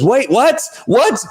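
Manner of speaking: high, stunned double take; shocked disbelief. Retold or imitated. Crowd hearing the vote count, high stunned disbelief. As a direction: gasp it out, stunned. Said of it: "everybody goes".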